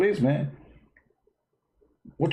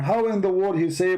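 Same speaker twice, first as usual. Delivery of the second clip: ordinary talk, nothing marked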